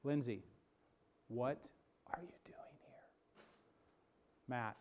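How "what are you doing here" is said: breathy unvoiced whisper, slowed; hushed whisper of the still small voice. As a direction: whisper it right to one person. Whispered to congregant Lindsay.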